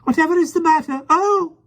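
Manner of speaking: very high, loud fluttery cry; flustered cry. A narrator's reading. Miss Lark cries out at the lion, very high fluster. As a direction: cry out like a fussy lady. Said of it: "she cried".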